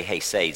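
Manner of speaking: hesed with a raspy throat sound at the start, hay-SAY; throaty hesed. Shown as a demonstration. Pastor demonstrates the guttural hesed to the congregation. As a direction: scrape the h from the back of the throat in hesed. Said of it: "you make that sound as you say".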